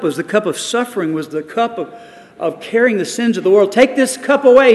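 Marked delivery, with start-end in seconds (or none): 3.7-4.7 higher, louder cry of anguish; pleading prayer. Acted. Jesus praying a second time in the garden, loud anguished plea. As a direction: beg with everything you have. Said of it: "he prayed the second time".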